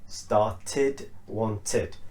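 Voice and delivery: "started", "wanted" split into star-tid, won-tid; id ending. Shown as a demonstration. Teacher modelling the first pronunciation, "started" and "wanted" ending in tid. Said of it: "with an id".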